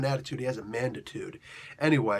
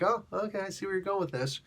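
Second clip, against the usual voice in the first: mock realization; 5 semitones higher, 5 dB quieter than usual. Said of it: "which is like".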